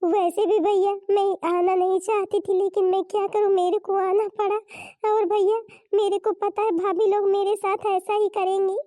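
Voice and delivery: put-on tearful pleading; plaintive victim act. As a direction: complain as the wronged little sister. Sister plays victim to brother.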